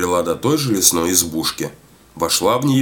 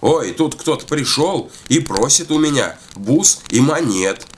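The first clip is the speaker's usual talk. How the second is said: complaining call, pitched up; crying out; 7 semitones higher than usual. A narrator's reading. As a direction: call out a complaint with a lightly raised voice. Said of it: "закричала она веряве". (жалобный выкрик повыше обычного)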